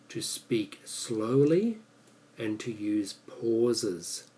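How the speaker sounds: drawn-out words with deliberate pauses; slow, with pauses. Shown as a demonstration. Teacher demonstrating the tip to learners, slow and paused. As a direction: speak slowly and leave clear gaps. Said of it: "like that".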